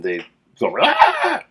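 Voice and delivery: sudden shrill high monster shriek; old movie monster ant screech; weird giant ant squeal. Retold or imitated. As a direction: screech like the giant ants in Them. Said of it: "does this weird squeal".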